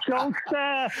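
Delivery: laughing "don't start"; laughing protest. Real, not acted. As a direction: brush off the teasing while laughing. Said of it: "I see you've lost your Liverpool accent".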